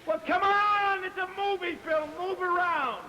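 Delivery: high, loud directing yell; exasperated shout. Acted. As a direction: yell impatiently at actors who won't move. Director yells at stiff actors.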